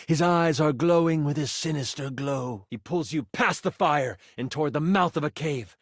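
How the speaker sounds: usual speaking voice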